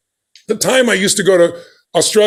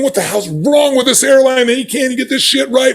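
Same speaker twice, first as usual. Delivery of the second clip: angry cursing; 5 semitones higher than usual. Retold or imitated. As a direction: vent like a furious passenger. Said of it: "They were cursing".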